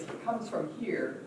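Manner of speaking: ordinary delivery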